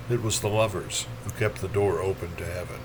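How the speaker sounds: soft, low echo of a verse line; poetry line let drop to a murmur; hushed refrain. Acted. Poem line repeated, much softer.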